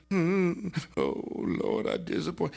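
mock feeble moaning; little moan. Preacher mimicking what Peter did not do, little moan. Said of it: "just a little moaning".

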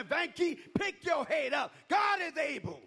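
loud, high call to lift up; high rousing cheer; encouraging shout. Retold or imitated. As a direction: shout it like a friend lifting you up. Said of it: "so they can tell me".

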